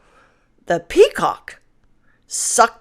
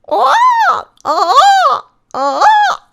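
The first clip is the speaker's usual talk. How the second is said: high piercing peacock shrieks, a little louder; 3 dB louder, much slower than usual. A narrator's reading. Peacock spreads his feathers and shrieks, piercing and high. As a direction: screech it out like a proud bird. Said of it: "set to shrieking".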